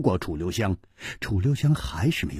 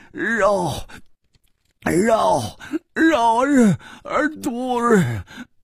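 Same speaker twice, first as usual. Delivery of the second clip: frantic crying out; 12 semitones higher, slower than usual. Acted. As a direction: wail the word over and over in agony. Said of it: "狂喊着". (小潘狂喊着)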